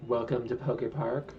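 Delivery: polite theme park greeter; chirpy welcome to guests; customer service voice. Pretend. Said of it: "you gotta use your, um, customer service voice".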